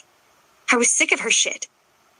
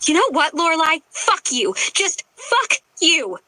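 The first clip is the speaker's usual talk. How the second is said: yelling; 7 semitones higher than usual. A narrator's reading. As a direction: spit the curse out. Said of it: "yelled in her face".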